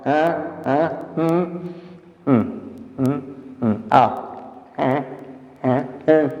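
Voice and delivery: drawn-out ah and hum sounds for diction practice; a voice exercise of open ahs and closed hums; vocal warm-up hums. Shown as a demonstration. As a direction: hum through the exercise slowly. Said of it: "Observa, ó".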